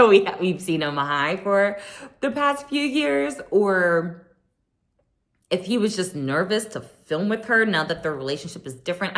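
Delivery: usual speaking voice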